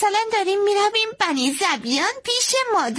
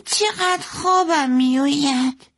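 put-on high, slow, drowsy child; sleepy little girl; 10 semitones higher, slower than usual. Little girl nodding off at supper, slow sleepy voice. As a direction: yawn it out in a small child's voice.

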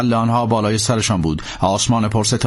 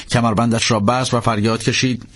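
plain reading voice

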